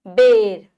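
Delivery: बेर said loud with a clear ए; emphatic e vowel. Shown as a demonstration. Teacher sounding out बेर for the children. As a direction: punch the ए in बेर. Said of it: "देखो ध्वनि ए वाली".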